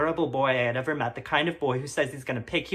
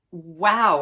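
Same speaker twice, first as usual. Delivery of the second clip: a bright, louder wow; exclaiming; 3 semitones higher, 8 dB louder than usual. Acted. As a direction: burst out in wide-eyed wonder. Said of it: "I exclaimed".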